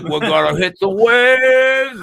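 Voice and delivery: slow, drawn-out California surfer dude; California surfer accent. Retold or imitated. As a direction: lay on the laid-back California surfer slur. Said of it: "California surfer dudes".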